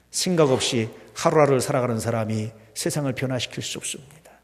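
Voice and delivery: ordinary talk, nothing marked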